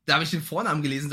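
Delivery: ordinary talk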